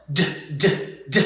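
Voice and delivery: "D" as a short voiced "duh"; short "d" bursts, not the letter name; isolated D sound. Shown as a demonstration. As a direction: tap out each "d" separately. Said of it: "now the D sound".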